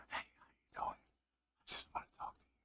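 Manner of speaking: breathy unvoiced whisper, very soft; mock ASMR whisper. Mocking ASMR girls whispering into the mic, soft whisper. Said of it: "like, whispering".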